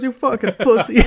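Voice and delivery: loud, high mock heckle; crowd heckler. Co-host quoting someone in the crowd, loud imitated heckle. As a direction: yell it like a jeering fan. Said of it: "someone in the crowd goes".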